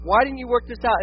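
high, aggrieved questioning; acted-out exasperated pleading; imitated protest. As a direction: complain upward to God, demanding a reason. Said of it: "in the unexpected, in the fear".

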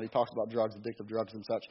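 ordinary talk, nothing marked

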